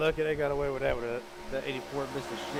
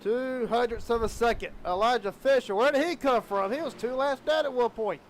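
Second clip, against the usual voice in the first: excited high race call; motorsport commentator; 8 semitones higher, 6 dB louder than usual. Real, not acted. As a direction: call the close finish with energy. Calls the photo finish.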